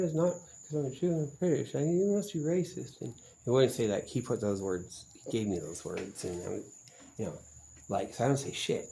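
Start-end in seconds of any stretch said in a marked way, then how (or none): 0.0-3.0 mocking the guy. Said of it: "He said".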